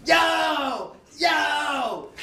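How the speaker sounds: very high shouted calls; hammed-up banging shout; mock yelling at door. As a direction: pound on the door and yell. Hack banging on bathroom door again.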